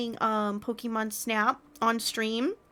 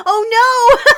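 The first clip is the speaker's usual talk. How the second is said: laughing; 12 dB louder than usual. Real, not acted. Laughing exclamation.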